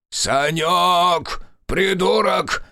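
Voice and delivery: put-on high, loud old man yelling upstairs; grumpy old grandfather. A narrator's reading. Grandfather yelling at grandson from below, loud. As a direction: bellow up the stairs at the fool grandson.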